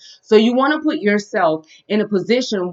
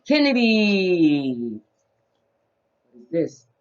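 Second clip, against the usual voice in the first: put-on reading to a toddler; 5 semitones lower, 8 dB quieter, slower than usual. Teaching toddler shapes.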